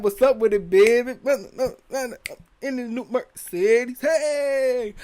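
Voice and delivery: loud, high, exaggerated New Orleans accent; mock New Orleans accent. Riffing on New Orleans locals, loud mock New Orleans accent. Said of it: "If we go down to New Orleans".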